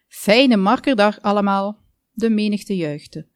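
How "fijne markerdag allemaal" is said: loud festive announcement to a crowd; public call. A narrator's reading. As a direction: call out the greeting loudly. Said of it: "tikte op de microfoon en riep".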